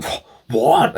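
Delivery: mock incredulous mechanic; performative disbelief. Mechanic reacting to annoyed customer, mock performative disbelief. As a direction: act out a put-on, astonished "what". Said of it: "all this performative".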